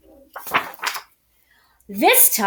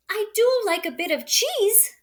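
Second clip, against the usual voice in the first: dainty mouse voice; 10 semitones higher than usual. A narrator's reading. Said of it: "said the mouse".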